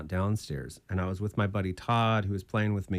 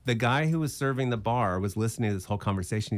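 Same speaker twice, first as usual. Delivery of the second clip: normal talking voice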